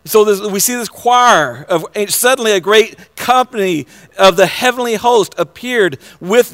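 loud, high, fervent preaching; preaching at full force. Acted. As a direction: lift it up and proclaim it to the room. Preaching voice raised again.

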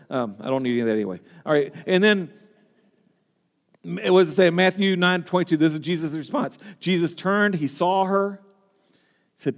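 ordinary talk

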